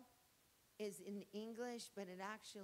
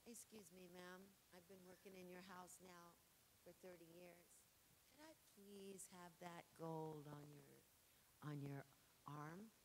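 a lightly voiced, soft and timid servant asking; polite servant; 2 semitones lower, 10 dB quieter than usual. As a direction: ask humbly, almost apologetically. Servant asking mistress for gold.

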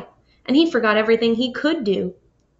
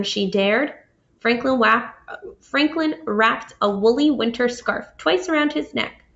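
ordinary delivery, nothing marked

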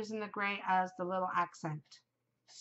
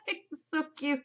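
laughing; 6 semitones higher, 4 dB louder than usual. Real, not acted. Laughing over Chucky figure.

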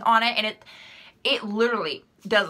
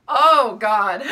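a burst of real laughter, pitch jumping high; laughing; 6 semitones higher, 6 dB louder than usual. Real, not acted. Answering the roommate question, she bursts out laughing. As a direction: crack up at the question. Asked about an opposite roommate.

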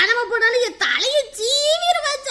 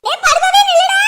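loud, high yell to stop; shouting after him; 8 semitones higher, 7 dB louder than usual. Acted. Woman yelling after the fleeing robber to stop.

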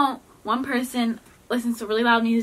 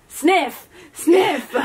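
raised, higher-pitched exclamation; loud guess; 7 semitones higher, 5 dB louder than usual. Real, not acted. Player in loud headphones guessing the partner's word, loudly. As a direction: blurt the guess out over headphone music.

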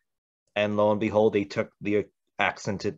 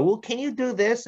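imitated client, pitched way up; wheedling request; 12 semitones higher than usual. Client asking the actor for another option, high and wheedling. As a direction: push politely in a high mock-client voice.

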